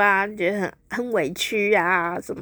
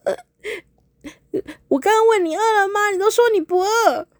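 high, plaintive wife's complaint, much higher; hurt girlfriend whining at the driver, much higher; aggrieved whine; 11 semitones higher, 3 dB louder than usual. Acted. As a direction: whimper out the grievance like a wronged girlfriend. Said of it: "觉得很哀怨哦".

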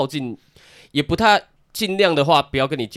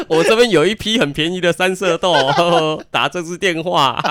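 slow, higher hammed-up salesman; mock sales pitch; 4 semitones higher, 5 dB louder, slower than usual. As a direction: hawk the beans like a TV ad. Parody hoarding sales pitch.

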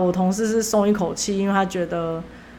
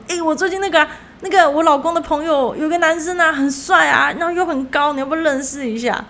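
热心同事的口吻; 7 semitones higher than usual. Retold or imitated. 同事热心介绍男生.

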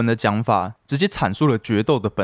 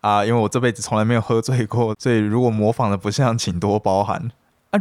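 usual speaking voice

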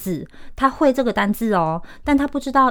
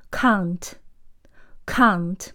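slow British "Can't" with a long ah vowel; British pronunciation. Shown as a demonstration. Teacher models can't for learners, British accent. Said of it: "让你读完三分钟后变英国人".

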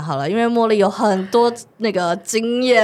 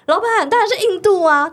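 fawning manager; 12 semitones higher, 4 dB louder than usual. Retold or imitated. Imitating manager's smooth reply.

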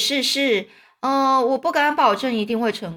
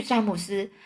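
plain reading voice

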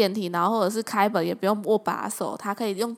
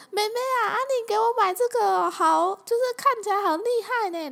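mom voice pitched much higher, gushing with delight; 11 semitones higher than usual. Retold or imitated. Mom thrilled with the mask her daughter bought. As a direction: gush like a thrilled mother.

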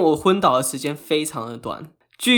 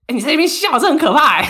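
high, louder half-laughing complaint; laughing protest; 10 semitones higher, 6 dB louder, faster than usual. Real, not acted. Scolding cohost for laughing at fainting, laughing protest. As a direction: scold her while laughing yourself.

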